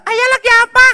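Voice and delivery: put-on high boy voice calling out, confused; child calling father. Boy Abdi calling his father, high and puzzled. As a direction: shout for dad like a lost little boy.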